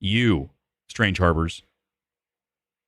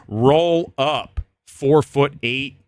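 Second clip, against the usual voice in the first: louder, slow taunt; taunting call-out; 4 semitones higher, 4 dB louder, much slower than usual. Pretend. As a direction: call him out, slowly. Imagined taunt outside theater.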